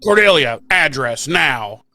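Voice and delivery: loud, slow, punched-out command; mock barked order. Echoing the phone demand for an address, as a mock bark. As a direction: imitate a demanding caller, dragging each word. Imitates the same shouted demand, louder.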